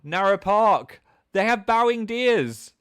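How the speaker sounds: high, loud burst of excitement; thrilled exclamation. Real, not acted. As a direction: squeal it with delight. Delighted reaction to chat question.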